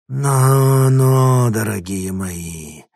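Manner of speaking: hoarse gurgling creature voice, slow at the end; gurgling fish man croak. Acted. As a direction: croak it out wetly like a swamp creature. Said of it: "раздался хриплый, булькающий голос".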